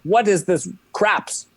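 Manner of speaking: puzzled question in an imitated French accent; Bernie Parent. Hockey legend asking about a script word, imitated French accent. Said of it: "still got a thick French accent".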